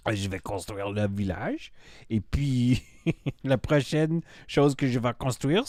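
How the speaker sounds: hammed-up French with rising phrase ends; bad French accent. Streamer announcing the next build, put-on French accent. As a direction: play at being French, amused at yourself. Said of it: "my French accent was so bad".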